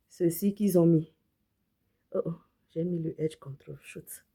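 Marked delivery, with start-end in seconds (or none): none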